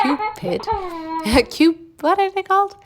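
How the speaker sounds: high, loud laughing disbelief. Real, not acted. Vlogger cracking up at own wrong word, laughing. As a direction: squeal it through real laughter.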